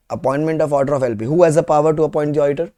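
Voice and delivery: ordinary talk, nothing marked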